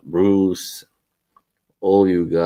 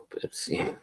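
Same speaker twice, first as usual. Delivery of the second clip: soft whispered aside; 13 dB quieter than usual. Real, not acted. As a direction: breathe it like a secret. Whispered aside about Pepsi.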